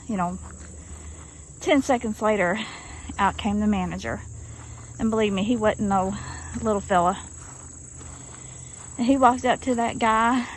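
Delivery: ordinary talk, nothing marked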